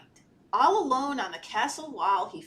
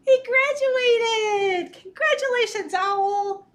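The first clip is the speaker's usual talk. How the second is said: high-pitched, drawn-out cheer; delighted high squeal; excited congratulations; 10 semitones higher, 5 dB louder, slower than usual. Pretend. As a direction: celebrate a story hero at full gush. Cheering Owl's graduation.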